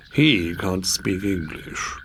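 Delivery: slow, soft, dry frog voice; sardonic frog. Acted. Frog telling the princess flatly, slow and soft. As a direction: drawl it out, unimpressed.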